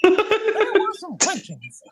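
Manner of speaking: high squeaky cartoon mouse voice; Steamboat Willie voice. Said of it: "in the Steamboat Willie voice".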